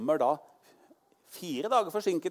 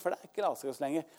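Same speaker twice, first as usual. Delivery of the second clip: usual speaking voice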